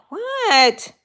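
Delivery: puzzled what. Real, not acted. Puzzled by a hard username.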